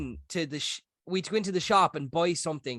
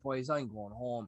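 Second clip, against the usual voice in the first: put-on sleepy drunk mumble; 6 semitones lower, 10 dB quieter, slower than usual. Drunk and falling asleep, leaving.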